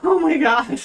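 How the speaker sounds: high, loud horrified gasp of words; retold dread. Student told to go back and confess, high horrified cry. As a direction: cry it out high in mock horror.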